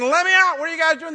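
loud, desperate yell through the door; yelling behind a locked door; shouting to be let out. Retold or imitated. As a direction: bang and holler to be let out. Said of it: "banging on the door saying".

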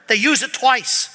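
ordinary talk

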